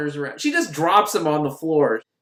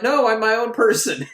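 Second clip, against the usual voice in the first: defiant protest; 7 semitones higher, 5 dB louder than usual. Retold or imitated.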